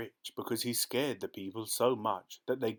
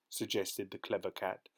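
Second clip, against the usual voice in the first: ordinary delivery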